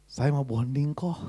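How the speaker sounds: a hint of a shy housemaid asking; lightly voiced maid. Pastor voicing his maid asking to borrow money, coyly.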